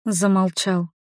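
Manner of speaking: plain reading voice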